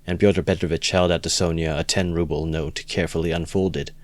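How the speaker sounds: ordinary delivery, nothing marked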